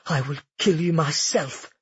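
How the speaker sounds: plain reading voice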